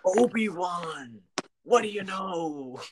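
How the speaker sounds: Dexter Jettster voice. Retold or imitated. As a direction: stretch every word like a burly alien. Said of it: "I could do a solid impression of him".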